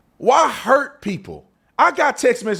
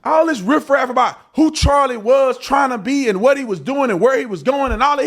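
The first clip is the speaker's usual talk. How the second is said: ordinary talk, nothing marked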